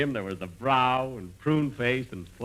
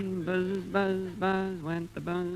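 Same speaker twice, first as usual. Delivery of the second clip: slow, soft storybook recital of the noises; sound effect recital; 4 semitones higher, 5 dB quieter, much slower than usual. Acted. Jack to the audience, reciting the bus ride sound effects. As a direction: drone out the clang and buzz like a sing-song rhyme.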